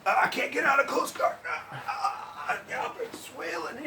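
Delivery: high, strained panicked whine. Retold or imitated. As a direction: whine like a stuck beginner. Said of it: "What I struggle with".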